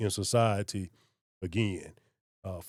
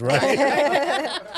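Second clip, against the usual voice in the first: laughing; 7 dB louder than usual. Real, not acted. Joking about unfit cop stories.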